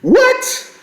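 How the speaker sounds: very high, drawn-out disbelief; incredulous what. Real, not acted. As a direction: hit a shocked high note. Reacting to coach's comment again.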